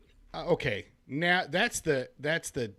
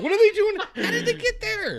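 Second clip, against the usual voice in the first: high, loud, incredulous squeak; baffled squeak; 4 dB louder than usual. Pretend. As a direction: squeak in mock disbelief. Mocking the confusing movie plot.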